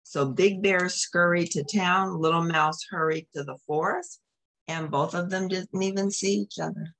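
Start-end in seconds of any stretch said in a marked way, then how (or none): none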